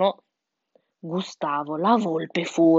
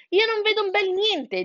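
high-pitched complaining chick; 13 semitones higher than usual. A narrator's reading. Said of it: "disse Sansone". (vocina acuta di pulcino)

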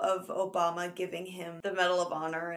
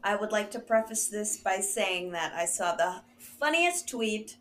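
usual speaking voice

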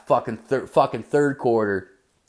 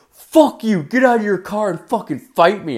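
high-pitched retold challenge to fight; angry driver; 9 semitones higher than usual. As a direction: mimic an enraged driver picking a fight.

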